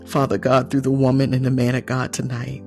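ordinary talk

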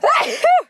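sudden loud sneeze; sneezing. Real, not acted. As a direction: let out a real sneeze. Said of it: "Excuse me".

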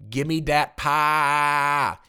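loud, long-drawn comment reading; very slow, booming mock demand; hammed-up holler. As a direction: stretch the last word out in a big holler.

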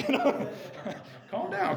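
laughing it off, real; laughing at his own outburst. Real, not acted. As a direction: chuckle through the words.

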